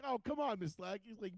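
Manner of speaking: high imitated nice-kid voice; pleading kid. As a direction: plead like a good-natured student.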